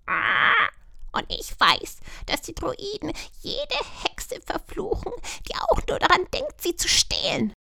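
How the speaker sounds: high, hissing magpie warning. A narrator's reading. Magpie Vika warning the witch, high and hissed. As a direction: croak the warning like a secretive bird.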